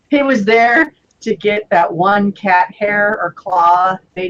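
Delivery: slow, solemn storyteller voice; mock solemn tale. Telling friends the lizard's tragic quest, slow and solemn. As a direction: spin it like a sad legend.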